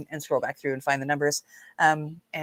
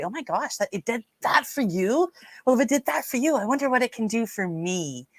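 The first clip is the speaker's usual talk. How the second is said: higher, questioning lilt of wonder; amazed onlooker; 5 semitones higher than usual. Acted. Imagined listener hearing a success story, acted amazement. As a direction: act out curious amazement, settling at the end.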